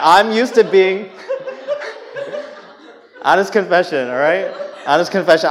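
laughing while admitting it, loud; a laughing honest confession. Real, not acted. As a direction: confess through real laughter.

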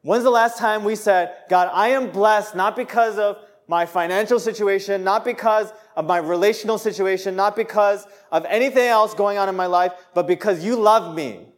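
high, loud, fervent preaching; passionate preaching. Acted. Preacher urging the congregation, loud and fervent. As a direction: pour it out at full force. Preacher building to full force.

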